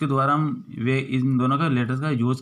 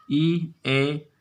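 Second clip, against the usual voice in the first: ordinary talk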